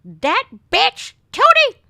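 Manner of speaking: "That bitch, Tootie!" yelled high and loud as the cursing step-grandmother; imitating step-grandmother. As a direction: screech it like a feisty old Polish grandma. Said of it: "you should hear the way she would talk".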